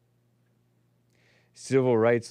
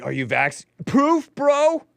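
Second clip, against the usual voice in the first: high, loud put-on demand; mock challenge; 12 semitones higher, 4 dB louder, slower than usual. Someone demanding proof of vax status, mock challenge. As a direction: pitch it way up like a pushy doorman.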